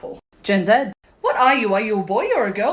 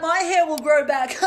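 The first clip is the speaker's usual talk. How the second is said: usual speaking voice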